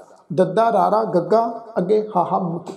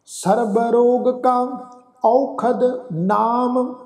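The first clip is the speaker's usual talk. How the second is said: scripture recitation; 3 semitones higher, slower than usual. Acted. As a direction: intone each phrase with long pauses.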